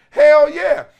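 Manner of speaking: loud high hell yeah; emphatic yell. Real, not acted. Host answering his own question with a loud hell yeah. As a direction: shout the answer out proudly.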